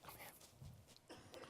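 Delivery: whispered beckoning. Retold or imitated. Crew member calling prize winners close, whispered. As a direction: whisper it conspiratorially.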